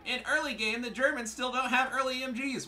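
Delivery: high, loud mocking voice; mock whiny opponent. Player parroting rival's early game excuse, high mock whine. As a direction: mimic the complainer far up in pitch.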